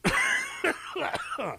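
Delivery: squealing high laugh. Real, not acted. Enjoying his own joke, high laugh.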